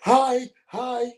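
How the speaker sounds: high, loud, sing-song repeated hi; mock Jericho greeting. Mocking Jericho's catchphrase to his cohost, in a high squeak. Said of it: "the word you're looking for".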